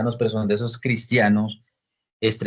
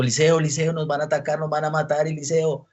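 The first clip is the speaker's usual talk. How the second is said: high, urgent plea to wake Eliseo; frightened servant crying out; 7 semitones higher than usual. Retold or imitated. Servant waking Eliseo before attackers come, frightened high cry. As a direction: call out in alarm, pitched high.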